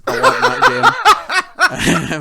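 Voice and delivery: drawn-out, high, loud gushing with a laugh; gushing want. Real, not acted. Craving a new game, a long high squealed gush. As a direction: squeal it slowly, overwhelmed with longing.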